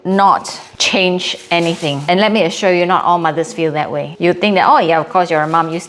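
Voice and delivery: ordinary talk, nothing marked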